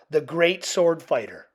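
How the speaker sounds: usual speaking voice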